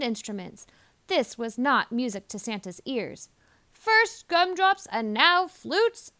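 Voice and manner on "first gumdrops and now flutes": Santa fretting. A narrator's reading. Santa's worried thought.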